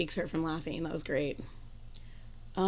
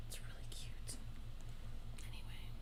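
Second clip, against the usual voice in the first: whispered aside; 10 semitones lower, 17 dB quieter than usual. Real, not acted. Aside while browsing.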